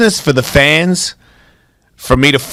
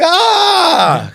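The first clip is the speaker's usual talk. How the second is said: mock yell; 13 semitones higher than usual. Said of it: "It would have been like".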